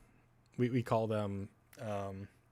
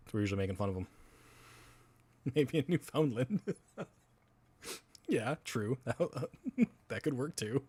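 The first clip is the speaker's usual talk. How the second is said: normal talking voice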